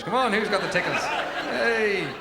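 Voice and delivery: high, teasing tickle-chase voice; playful tickling doctor. Acted. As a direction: tease a little child with tickles. Acted doctor chasing him to tickle.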